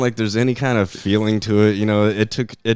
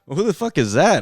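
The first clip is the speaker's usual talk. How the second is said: higher, louder mock outrage; baffled indignation; 9 semitones higher, 5 dB louder than usual. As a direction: demand an answer, replaying your disbelief. Retelling baffled reaction to daughter.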